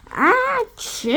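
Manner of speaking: loud high sneeze; huge sneeze. A narrator's reading. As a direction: sneeze so hard the nose flies.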